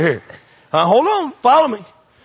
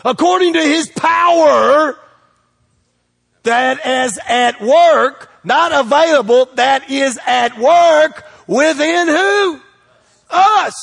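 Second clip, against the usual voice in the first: higher, louder, slow preacher's shout; full-force preaching; 9 semitones higher, 6 dB louder, slower than usual. Acted. Preacher shouting scripture to the church, slow and loud. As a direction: build it up and let it ring out to the church.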